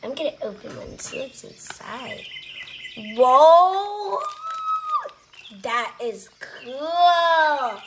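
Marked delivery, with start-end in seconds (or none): 3.2-7.8 slow and louder in awe; amazed, drawn out. Real, not acted. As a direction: stretch it out in amazement. Amazed by an opened egg.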